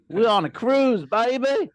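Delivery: high, loud whoop of a cruise brag; excited cheer. Acted. Host hamming up the cruise to his co-host, excited whoop. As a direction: crow about being on a cruise.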